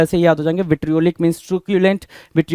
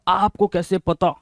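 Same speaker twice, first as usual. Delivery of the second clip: retold kid yelling; 5 dB quieter than usual. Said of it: "तो लल्ला चिल्ला रहा है".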